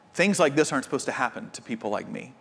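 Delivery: fast, soft, hurried inner thought; a soft fast aside to yourself; quick inner thought. Retold or imitated. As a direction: rush it softly, like thinking it to yourself. Voicing everyone's inner thought.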